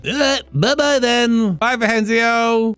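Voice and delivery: high, slow, drawn-out goodbye; silly farewell voice. Pretend. Character waving off the departing grown child, silly high farewell. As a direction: stretch the goodbye in a squeaky put-on voice.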